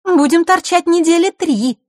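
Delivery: put-on very high, loud girl's voice; Маришка. A narrator's reading. Girl carries on the complaint, high-pitched and loud. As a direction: pipe up high and singsong. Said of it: "продолжила девчонка".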